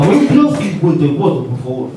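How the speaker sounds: newsman's voice, emphatic address then dropping; Pedro Piqueras. Acted. Passenger repeats the address to the driver, emphatic Piqueras impression. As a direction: call out the destination like a dramatic anchor. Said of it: "Me para el taxi Pedro Piqueras".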